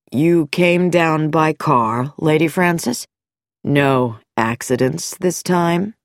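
a veiled threat from the doctor; sinister doctor. Acted. Dr Nicholson voiced.

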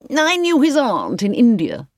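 high, emphatic gossip; Mrs Cardew Trench. A narrator's reading. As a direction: remark knowingly in a high-pitched voice. Cardew Trench gossips about family.

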